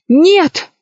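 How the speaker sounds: high, abrupt exclamation; sudden cry. A narrator's reading. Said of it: "вдруг закричала королева".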